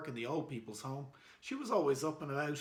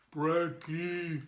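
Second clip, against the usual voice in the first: caveman voice. A narrator's reading. Caveman repeats word.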